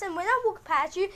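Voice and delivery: ordinary talk, nothing marked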